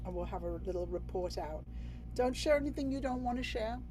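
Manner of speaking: ordinary delivery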